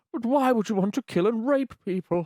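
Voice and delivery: high, aghast teacher voice; school teacher. Retold or imitated. School teacher questioning pirate ambition, high appalled voice. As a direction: ask it in high-pitched bewilderment. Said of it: "She was like".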